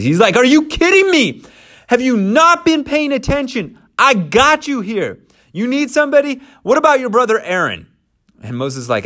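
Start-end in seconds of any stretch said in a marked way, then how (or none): 0.4-7.9 high, loud exasperated outburst; angry God. Acted. God exasperated at Moses's excuses, loud and high. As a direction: let the frustration blow up. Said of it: "the anger of the Lord is kindled".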